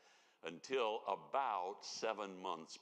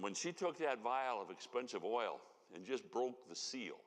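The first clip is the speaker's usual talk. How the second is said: normal talking voice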